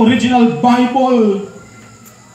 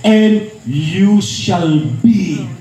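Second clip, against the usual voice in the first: lower, slow and measured Bible verse; solemn scripture reading; 8 semitones lower, slower than usual. Acted. Preacher reciting Acts to the church, slow and solemn. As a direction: read the verse slowly like a sacred proclamation.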